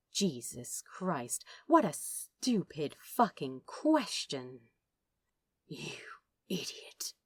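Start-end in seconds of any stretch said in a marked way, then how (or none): 5.7-7.1 hushed curse. Acted. Crowley hushed before growling.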